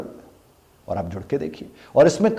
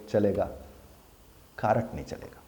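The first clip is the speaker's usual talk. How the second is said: usual speaking voice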